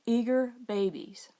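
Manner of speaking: ordinary delivery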